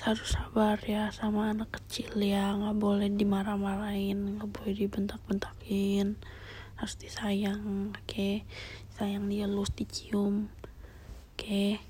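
gentle whispered plea. Real, not acted. Soft whispered pleading to listener. (bisikan membujuk)

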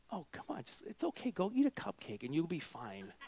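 soft, offhand parent brush-off; hushed, half-hearted comforting; dismissive soothing. Acted. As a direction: play down the crisis under your breath. Parent brushing off child's crying.

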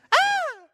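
high thrilled squeal; girl's delighted squeal. Retold or imitated. Pastor imitating a little girl opening a Barbie, high squeal. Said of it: "she goes, she just kinda".

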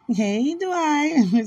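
"Dwight" drawn as "Dwa-ight", high. Shown as a demonstration. Greeting Dwight warmly, high drawn "Dwight". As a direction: slide an A into "Dwight". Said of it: "I put an A in it".

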